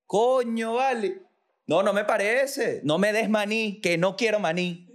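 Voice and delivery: high, outraged elephant voice; elephant ranting. Acted. Elephant refusing peanuts, high and outraged. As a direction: keep the high elephant going, annoyed.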